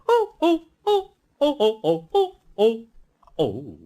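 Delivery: high, loud squealing cries; trucks crying out. Acted. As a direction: squeal as they bump together. Trucks bumping into each other.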